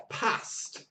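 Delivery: short northern A in "Past"; northern English accent. Retold or imitated. Teacher imitating a learner's friend, northern English accent. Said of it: "that's a great northern accent".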